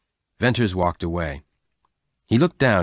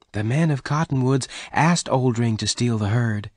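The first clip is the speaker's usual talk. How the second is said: higher-pitched character voice, earnest explanation; Bess, explaining; 4 semitones higher than usual. Acted. Bess tells Venters who ordered the theft, explaining. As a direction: voice her higher as she explains.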